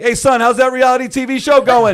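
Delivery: dad needling his son, loud and high; dad's nagging. Retold or imitated. Dad greets son walking into the office, loud nagging. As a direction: bellow it across the office like Dad. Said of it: "my dad would be like".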